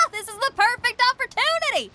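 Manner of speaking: laughing, high and thrilled; excited laugh. Real, not acted. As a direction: burst out laughing with joy at the chance. Xinyan thrilled by the plan.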